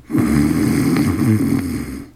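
long, low imitated snore; mock snore. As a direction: drop off into a drawn-out snore. Blondie falls asleep mid-sentence.

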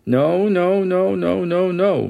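put-on a child's panicked shriek, no after no. A narrator's reading. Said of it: "my daughter shrieked".

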